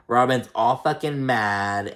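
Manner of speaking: usual speaking voice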